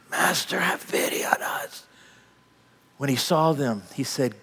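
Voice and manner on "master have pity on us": whispered plea. Retold or imitated. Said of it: "you see these guys in rags".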